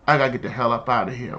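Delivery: low, fast, leaning-in confession; urgent low whisper-like aside; low rushed aside. Retold or imitated. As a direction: mutter it quickly out of the side of your mouth. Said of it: "he leans and he was like".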